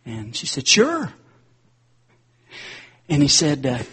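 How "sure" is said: chirpy agreement. Retold or imitated. Lady answers Wesley.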